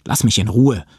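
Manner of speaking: aufgebracht. Acted. Josh wütend zu Toni.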